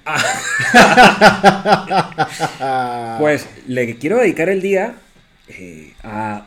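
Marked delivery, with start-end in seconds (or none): 0.2-3.1 loud laugh. Real, not acted. Laugh at joke.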